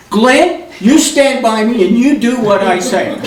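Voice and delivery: high, loud bossy order; bossy command. Retold or imitated. Ida ordering Glenn to stand by her, high and loud. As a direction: take charge of him.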